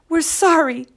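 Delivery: high little-chick apology; a touch of sorrow, pitched high; mildly sniffly apology. A narrator's reading. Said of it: "they sniffed".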